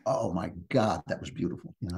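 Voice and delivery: softly whispered "Oh my God, that was beautiful."; awed whisper. Retold or imitated. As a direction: breathe it out like you're still stunned by the play. Retelling awe leaving the theatre.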